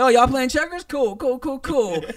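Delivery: high, loud mocking put-on voice; mock condescending voice. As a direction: talk down like a smug know-it-all. Mocking someone at checkers.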